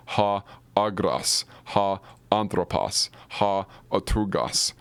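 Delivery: "Ha, agras, ha, anthropos, ha, otugas" spoken slowly, each Greek word spelled out carefully; slow Greek demo. Shown as a demonstration. Teacher models Greek vocabulary for students, slow careful pronunciation. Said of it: "go practice pronouncing these".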